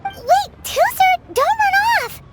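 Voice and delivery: high, loud call to a friend darting away; yelling after a friend who runs off; calling after someone. Acted. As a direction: shout to stop him running off.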